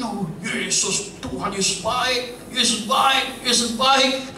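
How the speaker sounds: high, elated praise, hammed up; exaggerated happy worship cries; mock joyful praise. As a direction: imitate a freshly blessed believer rejoicing. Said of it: "Tapi begitu diberkati".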